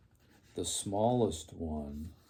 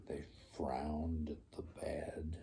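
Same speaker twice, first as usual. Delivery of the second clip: whispered; 6 semitones lower, 11 dB quieter than usual. A narrator's reading. Frowning at the bad.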